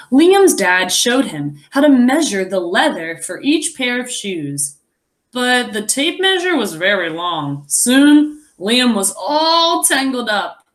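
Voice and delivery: put-on lively read-aloud to children; story narration. A narrator's reading. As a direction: tell the story to the class, expressive. Reads the picture book aloud.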